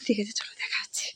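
very soft, low whisper; whispered sign-off. Real, not acted. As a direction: whisper it so nobody wakes. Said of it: "আমরা আস্তে আস্তে কথা বলছি". (ফিসফিস করে)

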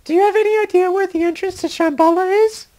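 high-pitched woman's voice; high female voice. Acted. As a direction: play her high and light. Elena voiced.

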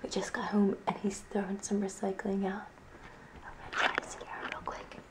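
hushed whisper while hiding. Real, not acted. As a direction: whisper so he won't hear. Hiding to scare Jesse.

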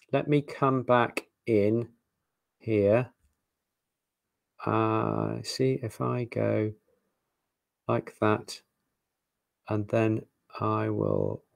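half to yourself, slow and quiet; talking to self. Real, not acted. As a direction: think aloud softly while fiddling with the computer. Muttering to self while working the screen.

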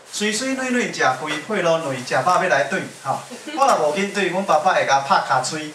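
Taiwanese Hokkien in a Yilan accent. Shown as a demonstration. Singer demonstrating Yilan dialect to the audience, playful Yilan accent. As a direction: show off the Yilan Zhangzhou-style accent. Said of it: "就是我们宜兰腔".

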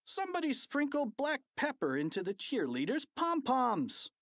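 high, outraged call; fan's shouted complaint. A narrator's reading. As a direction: announce the prank to the whole stadium. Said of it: "shouted a fan in the bleachers".

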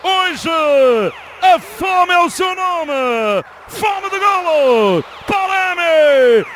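screaming goal call, stretched and high; fast dramatic football commentary. Acted. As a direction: roar the goal with everything.

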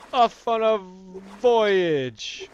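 loud, high, drawn-out proclamation; hammed-up announcement. Gamer setting off by boat, hammed-up proclaiming.

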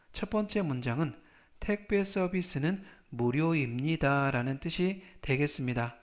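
normal talking voice

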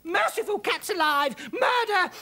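high, loud panicked cry of murder; terrified shriek. Acted. Mother panicking at muddy figures, terrified.

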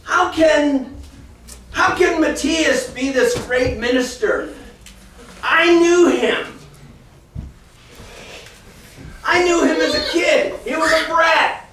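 mock scoffing. Preacher voicing people who knew him as a kid, mild mock scoff. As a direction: play the hometown skeptic, half joking. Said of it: "all the people around you go".

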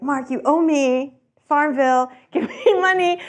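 high, slow, playful pushing; cheeky demand. Retold or imitated. To her old boss Mark, a cheeky high demand. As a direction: lean on an old debt, teasing. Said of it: "I was like".

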